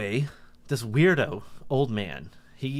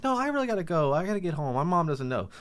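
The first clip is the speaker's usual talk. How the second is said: anxious excuse; 8 semitones higher than usual. Retold or imitated. Child pleading to leave, retold.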